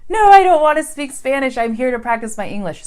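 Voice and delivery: loud, high frustrated outburst; frustrated protest. Retold or imitated. Learner refusing to be spoken to in Spanish, loud frustrated protest. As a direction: push back loudly, annoyed. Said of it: "you might get frustrated and go".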